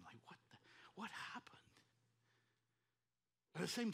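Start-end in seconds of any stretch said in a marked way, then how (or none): 0.3-1.6 hushed, whispered disbelief; retold tearful shock. Father arriving at his son's car crash, retold tearful whisper. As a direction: barely voice it, as if stunned. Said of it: "just crying".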